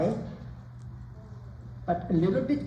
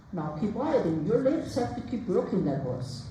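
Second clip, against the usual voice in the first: normal talking voice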